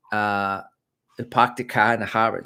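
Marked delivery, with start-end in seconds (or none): 1.2-2.4 badly faked Boston accent, dropped r's; terrible Boston accent. Shown as a demonstration. Vlogger attempts the park-the-car line, poor Boston accent. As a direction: have a clumsy go at a Boston accent. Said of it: "That's terrible. I'm not gonna do the accent".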